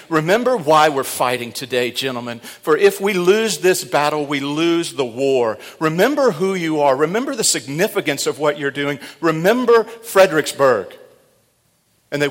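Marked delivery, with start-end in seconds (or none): 0.1-9.0 loud, raised, urgent exhortation; rousing rally speech. Retold or imitated. Commander rallying his men mid-battle, loud and urgent. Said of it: "he was saying". 9.2-10.9 loud rallying cry. Retold or imitated. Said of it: "he was saying".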